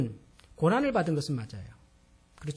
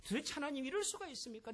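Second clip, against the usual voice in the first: softer, higher pleading protest with 이럴 falling; protesting to God; 8 semitones higher, 11 dB quieter than usual. Retold or imitated. Job arguing with God in his suffering, a soft, higher challenge. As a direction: quietly challenge God, pressing on 이럴. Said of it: "하나님 앞에서도 그렇게 막 따지듯이 대들어요".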